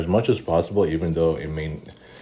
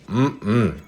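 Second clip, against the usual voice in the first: savoring hum; 5 semitones higher than usual. Real, not acted. Savoring a bite of potato salad.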